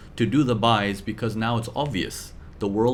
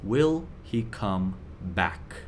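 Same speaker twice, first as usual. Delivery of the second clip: lower, softer and slow, worried; anxious question, quiet and slow; 4 semitones lower, 6 dB quieter, much slower than usual. Retold or imitated. Teacher voicing trader's worried question, soft and slow. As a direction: ask it under your breath, slowly. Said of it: "you're thinking one question".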